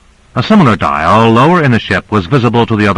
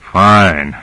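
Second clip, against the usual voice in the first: groaning; 2 semitones lower than usual. A narrator's reading. Grant hearing the ride will get worse, groaning. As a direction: groan it, resigned to the nausea. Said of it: "groaned Grant".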